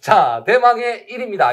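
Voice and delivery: loud drumroll build-up; hyped giveaway announcement. Real, not acted. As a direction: hype up the grand prize. Announcing grand prize. (신나는 대망의 일 위 발표)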